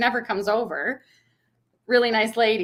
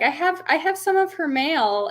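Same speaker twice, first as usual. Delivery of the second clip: put-on old lady neighbor; 8 semitones higher than usual. Old neighbor excuses her mistake, high imitated voice. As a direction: explain as the elderly neighbor, pitched way up. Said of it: "she's like".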